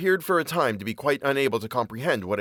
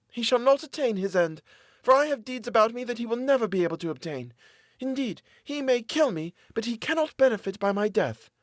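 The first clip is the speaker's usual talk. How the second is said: much higher, desperate woman begging; pleading captive woman; 12 semitones higher than usual. A narrator's reading. Captive woman pleading with her jailer for mercy. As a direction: beg for mercy in a high woman's voice.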